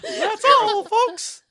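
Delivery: very high, loud cartoon voice; high squeaky toon farewell; cartoon sign-off. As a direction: squeak out the closing line like a cartoon. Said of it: "That's more of a Warner Brothers thing".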